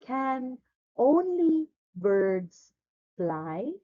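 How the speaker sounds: slow, spaced-out question for repeating; slow model reading. Shown as a demonstration. Teacher modelling the lesson question for Ella to repeat, slow reading. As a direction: model each word slowly for a learner. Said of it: "Please repeat".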